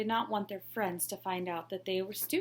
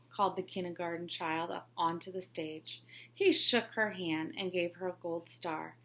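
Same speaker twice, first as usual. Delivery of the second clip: ordinary delivery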